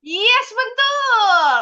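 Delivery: loud, high, stretched-out approval; excited praise. Real, not acted. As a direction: cheer the students' correct answer, drawn out. Guru memuji jawaban murid. (memuji dengan semangat)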